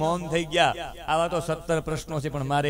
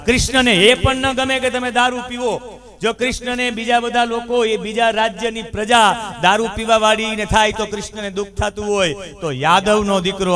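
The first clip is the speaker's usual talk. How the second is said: fervent preaching; 3 semitones higher, 5 dB louder than usual. Acted. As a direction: preach it hard, pleading with the crowd against drink. Preaching at full force. (જોશભેર ઉપદેશ)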